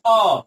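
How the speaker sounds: अ said loud and short; short vowel. Shown as a demonstration. Teacher demonstrating how quickly it is spoken, short अ. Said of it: "अ बहुत जल्दी हम बोल दे रहे हैं".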